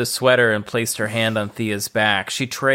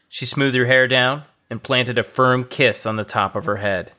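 ordinary delivery

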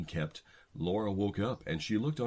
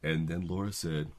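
ordinary talk

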